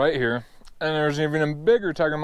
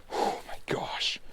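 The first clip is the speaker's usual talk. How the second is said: breathless, slow whisper of awe; awed whisper; 10 dB quieter, slower than usual. Real, not acted. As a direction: whisper it, stunned. Whispered awe after landing.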